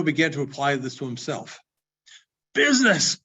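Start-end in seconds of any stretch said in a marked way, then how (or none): 2.5-3.2 a loud, high burst of protest; anguished cry. Acted. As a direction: cry it out, wringing his hands. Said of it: "cried the ghost".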